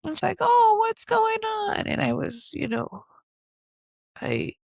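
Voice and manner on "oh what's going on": imitated doctor, high, drawn-out concern; doctor's concern. Doctor sees her crying, imitated in a high, slow coo. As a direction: coo it slowly, high-pitched.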